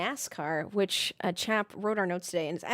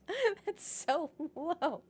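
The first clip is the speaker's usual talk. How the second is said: laughing; 9 semitones higher, much slower than usual. Real, not acted. Laughing at a low race rating.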